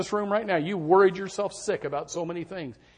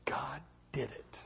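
hushed whisper, slow; whispered testimony; 3 semitones lower, 18 dB quieter, slower than usual. Real, not acted. Preacher whispering his testimony to the congregation. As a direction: breathe it out reverently.

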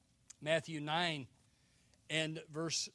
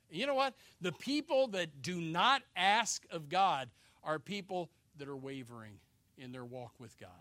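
normal talking voice

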